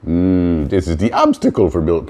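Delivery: put-on silly made-up European accent mixing niet and nein; vague fake foreign accent. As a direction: ham up an unplaceable foreign accent as Life itself. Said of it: "Why am I doing a fake accent that I can't even identify".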